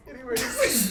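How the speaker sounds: high, loud laughing "anyways". Real, not acted. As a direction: laugh it off and move on. Laughing after false sneeze.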